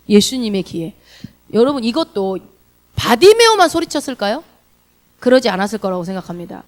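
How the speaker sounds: usual speaking voice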